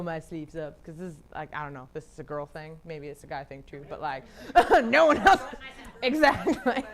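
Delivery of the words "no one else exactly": high, loud, laughing agreement; real laughter breaking into the talk. Real, not acted. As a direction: laugh through the words. Laughing amid own joke.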